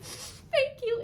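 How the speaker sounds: high, crying thank you; choked up with relief; tearful thanks. Retold or imitated. Thanking her boss through tears, high-pitched. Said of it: "I'm just like".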